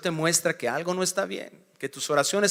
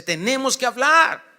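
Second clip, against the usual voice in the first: wife's demand; 6 semitones higher, 5 dB louder than usual. Acted. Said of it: "Y la esposa dice".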